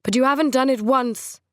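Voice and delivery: aggrieved. A narrator's reading. Trent to Coral over his pet name, aggrieved whine. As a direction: sulk about the nickname.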